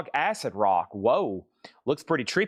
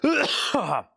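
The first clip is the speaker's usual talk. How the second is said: choking cough; 10 semitones higher than usual. Real, not acted. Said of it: "I just choked on a piece of ice".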